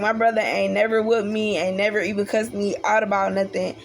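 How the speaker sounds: ordinary talk, nothing marked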